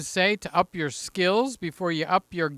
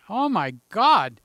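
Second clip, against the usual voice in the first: normal talking voice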